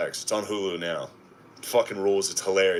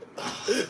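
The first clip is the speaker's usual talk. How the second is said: drained sigh; 13 semitones higher, 4 dB quieter than usual. Retold or imitated. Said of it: "people are just like".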